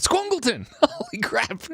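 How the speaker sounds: high, delighted cry; excited shout of the name. Real, not acted. Spotting a viewer's name, excited high cry. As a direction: greet the donor with a burst of glee.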